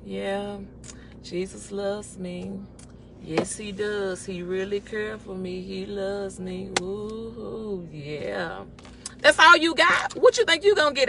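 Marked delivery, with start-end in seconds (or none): 0.0-8.6 slow, soft, low drone; mock a half-hearted worshipper, barely any energy; listless mumbled praise, dragged out; bored. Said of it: "If you boring and just like".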